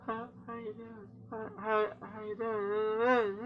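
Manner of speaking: high, soft, stretched-out stutter; glitchy head voice. Retold or imitated. Game voice in the player's head, slow glitchy stutter. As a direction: drag it out like a broken recording.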